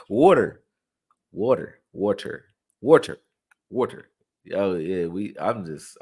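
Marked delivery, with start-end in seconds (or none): 0.1-4.1 "Water" repeated with a rounded "waw" vowel; own accent pronunciation. Shown as a demonstration. Playing with his own accent for viewers, "Water" as "waw-ter". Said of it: "I just talk like that".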